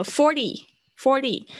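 "Forty" with a tapped, weakened t, stressed on "For"; softened T. Shown as a demonstration. Teacher showing why T weakens, flapped "Forty". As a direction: say "Forty" slowly, high first syllable, t softened to a flap. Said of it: "我们先这样讲好了".

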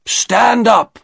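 high, forceful shopkeeper's order; loud command. A narrator's reading. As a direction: order him up loudly. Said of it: "said the shopkeeper loudly".

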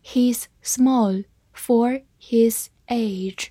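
very slow, separate words; slow reading. Shown as a demonstration. Teacher models the sentence for learners to follow, slow reading. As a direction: leave a clear pause after each word. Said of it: "慢慢来读".

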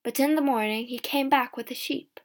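plain reading voice

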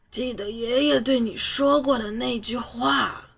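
put-on slow, weak old man voice; last words, fading; dying old man. A narrator's reading. Dying old master speaks.

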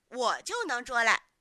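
put-on loud, cocky child's claim; chest-thumping boast. A narrator's reading. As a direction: insist loudly that you can. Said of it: "小牛拍着胸脯说".

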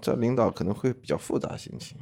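ordinary talk, nothing marked

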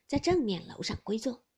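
usual speaking voice

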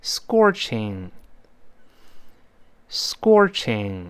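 scorching said slowly, syllable by syllable; very slow, careful scorching; read-along model. Shown as a demonstration. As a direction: model scorching slowly for repeating, with a pause after each. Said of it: "大家先跟老师读两遍吧".

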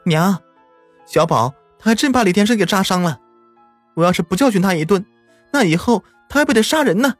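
pleading her case to her mother-in-law; upset protest. A narrator's reading. Mother insists to grandma that the boy must be punished, upset.

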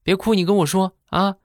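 high, fussing wife; worried wife. Retold or imitated. Wife at the door coaxing her husband, high and worried.

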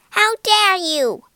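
loud, high indignant outburst; angry cry. A narrator's reading. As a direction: cry out in outrage. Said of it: "she cried".

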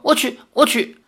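high, hurried giving-in; frightened. A narrator's reading. Suitor giving in to grandmother's threat, frightened. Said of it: "李逍遥吓得说".

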